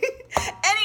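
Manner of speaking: short laugh after the facelift joke, pitch jumping up; high laugh. Real, not acted. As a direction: let a real giggle out.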